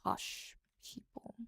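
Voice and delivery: put-on slow, whispered posh British accent. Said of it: "Where's the accent from?".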